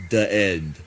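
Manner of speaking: ordinary delivery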